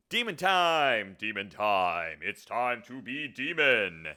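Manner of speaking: loud, drawn-out mock announcement; demon hype. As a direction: holler it slowly and big. Hypes self into demon role.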